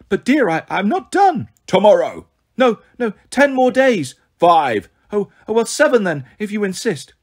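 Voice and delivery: high, pleading falsetto. Acted. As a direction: bargain nervously up high. Grover stalling in falsetto.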